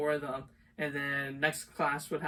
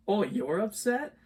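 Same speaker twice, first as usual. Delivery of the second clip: raised, louder mock disbelief; retold incredulous retort; 6 semitones higher, 5 dB louder than usual. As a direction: throw it back at her in disbelief. Retold retort to upset girl.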